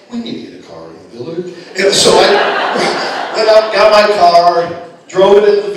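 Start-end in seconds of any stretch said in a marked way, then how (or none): 0.1-1.5 low, soft, quick mock reply; mocking the clerk. Father mimicking the desk boy, low and soft. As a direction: parrot the clerk back at him. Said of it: "he went".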